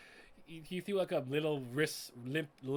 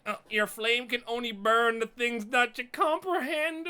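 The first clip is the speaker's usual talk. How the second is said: mock villain line; 6 semitones higher, 6 dB louder than usual. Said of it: "He's like".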